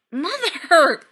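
loud, high exasperated cry; near hysterical. A narrator's reading. Son cutting off his rambling mother, near hysterical. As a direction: cry out, barely holding it together. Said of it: "just this side of hysterical".

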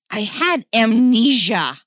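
loud, slow indignant outburst; high, exasperated self-defence; hissed protest. Acted. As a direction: defend yourself, stressing each word. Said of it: "Dick hissed".

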